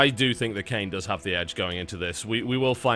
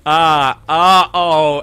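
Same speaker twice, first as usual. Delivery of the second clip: loud delighted outburst; excited shout; 4 semitones higher, 11 dB louder, much slower than usual. Real, not acted. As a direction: burst out in excitement. Caster reacts to trash talk.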